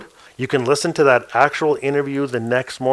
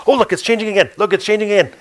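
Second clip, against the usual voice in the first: higher, breathless wonder breaking in; excited interruption; 7 semitones higher, much faster than usual. Retold or imitated. Maria breaking off mid-sentence to point at the walls, thrilled. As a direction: cut yourself off in amazement.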